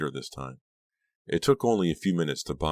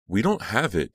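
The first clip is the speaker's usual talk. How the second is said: higher, louder desperate cry; 6 semitones higher, 5 dB louder than usual. A narrator's reading. Dina crying out to the gunman in denial. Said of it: "Dina cried".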